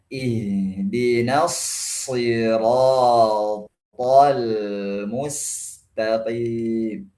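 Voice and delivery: slow, drawn-out measured recitation; Quran recitation. Shown as a demonstration. Teacher reciting the verse for students, slow tajwid recitation. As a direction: recite it very slowly, stretching each long syllable.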